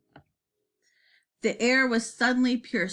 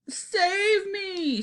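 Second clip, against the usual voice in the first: high, wailing cry for help; panicked giant's shriek; screamed plea; 10 semitones higher than usual. A narrator's reading. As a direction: scream it in terror, words drawn out. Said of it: "screamed the flesh lump eater".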